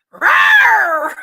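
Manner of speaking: loud playful roar like Brad; shouted cartoon roar; mock roar. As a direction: let out a big hammed-up roar. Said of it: "Let's do one of Brad's roars".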